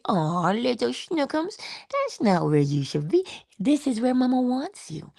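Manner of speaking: put-on cooing to a baby. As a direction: coax the little one like a doting mom. Parent coaxing baby back.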